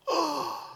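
sharp, high, startled gasp; shocked gasp. Retold or imitated. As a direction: gasp in disbelief. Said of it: "the disciples would be shocked".

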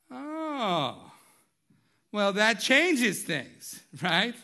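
loud, high, very slow "oh well"; exaggerated realization. Husband suddenly gets what his wife meant, hammed-up surprise. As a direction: ham up the sudden understanding.

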